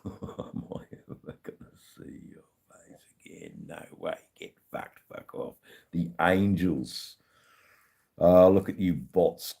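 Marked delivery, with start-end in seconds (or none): 0.0-5.5 barely voiced, whispery quote; very soft, breath-only recital; hushed song quote. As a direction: murmur the chant under your breath. Quoting a song and crowd chant.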